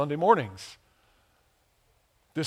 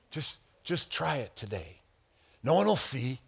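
ordinary talk

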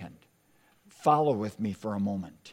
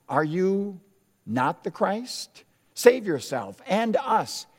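high, louder sneering challenge; mocking criminal; 8 semitones higher, 4 dB louder than usual. The preacher voicing the criminal mocking Jesus, higher. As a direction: taunt him from the cross. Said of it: "And the criminal asked".

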